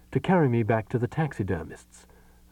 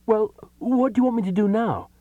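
raised and helpless; high pleading voice; 13 semitones higher, 5 dB louder than usual. Acted. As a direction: ask in a high plaintive voice. Character pleads with the voice.

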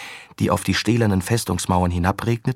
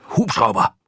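calling out; 2 semitones higher, 4 dB louder than usual. Acted. Commander calling the helicopter.

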